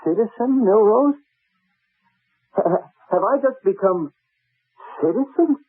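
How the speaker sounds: rising alarm at the new title, pitch climbing to a squeak on citizen; shocked disbelief. Acted. Milrose realising he has been demoted, stunned disbelief.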